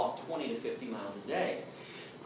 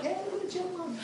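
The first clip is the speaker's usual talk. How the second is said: high, airy mock-casual voice, much higher; casual passerby; 3 dB quieter than usual. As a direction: toss it off lightly, like a happy passerby. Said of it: "I'm like".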